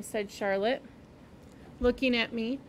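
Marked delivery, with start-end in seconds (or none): none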